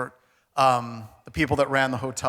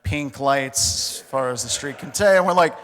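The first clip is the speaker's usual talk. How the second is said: ordinary talk, nothing marked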